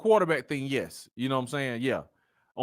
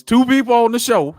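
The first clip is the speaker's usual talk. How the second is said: gloating call-out; 10 semitones higher, 9 dB louder than usual. Real, not acted. Gloating over a correct prediction.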